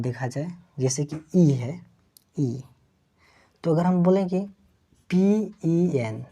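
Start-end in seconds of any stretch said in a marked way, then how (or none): 5.1-6.2 very slow letter by letter; slow spelling. Shown as a demonstration. Teacher spells the word slowly for students. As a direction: stretch each letter name. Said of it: "तो देखिए तो".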